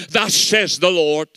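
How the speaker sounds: slow, mock-prophetic declaration; weighty churchy pronouncement; Pentecostal proclamation. Shown as a demonstration. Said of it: "That's a Pentecostal wrapping".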